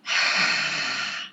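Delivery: deep breathy ha, like fogging glasses; ha breath sound. Shown as a demonstration. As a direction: breathe out ha to clean your lenses. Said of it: "start doing this ha sound like that".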